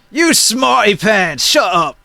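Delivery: high, irritated giant retort; squabbling giant telling off a know-it-all. Acted. Giant silencing the one who corrected him, high giant voice. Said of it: "And this giant says".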